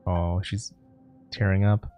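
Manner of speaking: usual speaking voice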